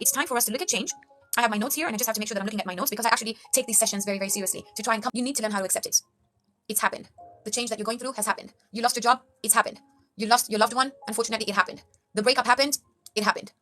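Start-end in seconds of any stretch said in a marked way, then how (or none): none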